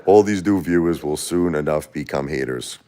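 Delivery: ordinary talk, nothing marked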